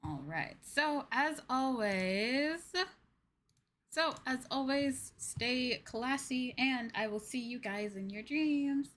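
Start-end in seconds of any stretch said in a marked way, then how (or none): none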